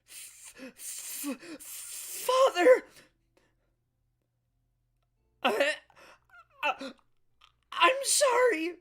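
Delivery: high, loud stammering cry, drawn out; a boy sobbing out an apology; anguished child. Acted. Son cries out to his father, high and broken.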